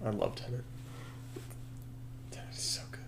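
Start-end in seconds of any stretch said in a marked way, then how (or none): 2.3-3.0 real half-whispered aside; hushed aside. Real, not acted. Film fan gushing to co-host about Tenet, hushed. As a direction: murmur it, almost voiceless.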